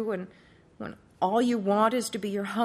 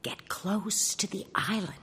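soft unvoiced whisper; little voice whispering; 5 dB quieter than usual. A narrator's reading. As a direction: whisper it close to the ear. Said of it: "Then the voice said".

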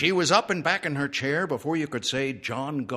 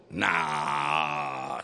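low, drawn-out disgusted refusal; horse's deep, scornful no; 9 semitones lower than usual. A narrator's reading. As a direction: drop low and drag it out in disgust. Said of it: "said Ed disgustedly".

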